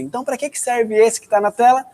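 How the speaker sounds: ordinary delivery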